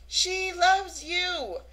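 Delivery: slow, high, drawn-out declaration; emphatic appeal. Retold or imitated. Friend pointing at Amanda, slow emphatic appeal. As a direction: stretch each word to make him get it.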